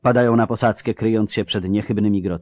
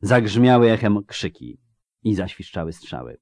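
ordinary delivery

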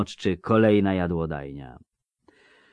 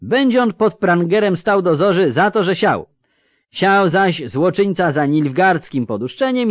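high-pitched, worked-up townsman; indignant burgher; 7 semitones higher, 3 dB louder than usual. A narrator's reading. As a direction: proclaim the charge hotly in a raised pitch. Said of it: "zaperzył się mieszczanin". (oburzony mieszczanin, podniesionym głosem)

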